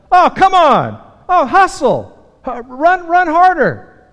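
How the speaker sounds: loud, high yell from the stands; yelling at the game. Retold or imitated. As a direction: shout at the players to hustle. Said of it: "And you start yelling".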